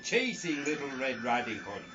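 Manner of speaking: ordinary delivery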